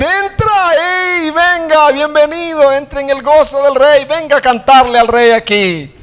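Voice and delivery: very high, loud, calling out; joyful welcome. Acted. Subjects welcome the singer into the palace, very high and joyful. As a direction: welcome him in jubilantly.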